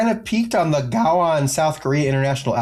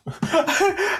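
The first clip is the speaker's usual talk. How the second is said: high burst of laughter. Real, not acted.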